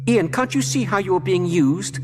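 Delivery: desperate plea. A narrator's reading. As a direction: beg him to see. Pleading with entranced Ian.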